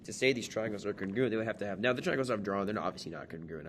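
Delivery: usual speaking voice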